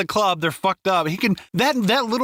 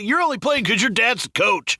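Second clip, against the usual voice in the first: mocking heckler; 7 semitones higher than usual. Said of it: "He's like".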